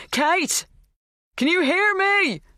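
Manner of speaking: loud, high, slow calling; shouting. A narrator's reading. Dan calling for Kate through the door, shouting. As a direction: stretch the call out to reach her.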